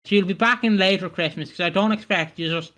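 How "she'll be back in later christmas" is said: ordinary talk, nothing marked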